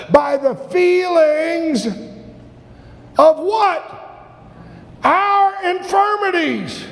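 rising, fervent preacher's cry; building sermon climax. Real, not acted. Preaching builds to full force.

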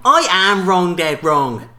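Floyd Mayweather impression. Quiz contestant boasting about the rivalry, slow, loud boxer swagger with a laugh. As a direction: puff it up slowly like a boxer hyping himself, laughing through it. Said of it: "That's my impression".

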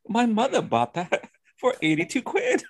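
high put-on voice of a proud older lady; mock British lady. Imitating the fifty-year-old woman on the show, high put-on voice. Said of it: "fifty-year-old woman".

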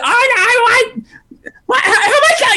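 high-pitched wailing protest; hammed-up screeching mother; mock screaming mom. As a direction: shriek it in exaggerated despair. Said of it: "and just screaming".

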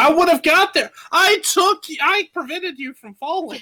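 high, offended protest; high-pitched indignant whine; mock indignant squeak. Real, not acted.

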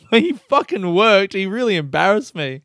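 usual speaking voice

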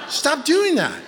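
high-pitched comic scolding; exaggerated, high telling-off; mock blunt advice. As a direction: deliver the blunt order as a punchline. Repeats mock counseling advice.

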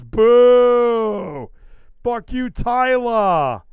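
high, loud booing jeer; loud mock heckle. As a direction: heckle like a rowdy fan. Reading a chat message aloud.

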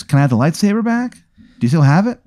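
high, put-on questioning voice; lightly hammed-up request; asking character. Game master voices character asking.

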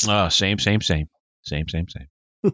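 playful shaming chant, slowing and dropping; mock scolding. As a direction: chide a friend in mock disapproval. Mock-scolding a friend's unread comic.

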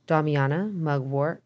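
ordinary delivery

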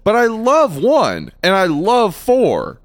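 high, slow, drawn-out exasperation; bewildered self-questioning. Retold or imitated. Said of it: "I get into the mind of".

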